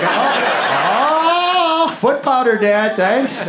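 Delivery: high, gushing fake delight; a kid's overdone gift thanks; mock thrilled kid. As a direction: squeal it like a kid unwrapping.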